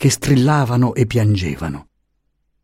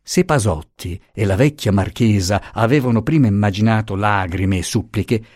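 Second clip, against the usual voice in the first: plain reading voice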